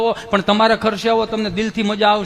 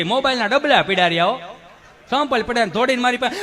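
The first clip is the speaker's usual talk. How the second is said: normal talking voice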